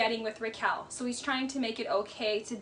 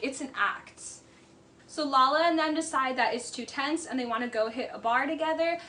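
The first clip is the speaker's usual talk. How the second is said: usual speaking voice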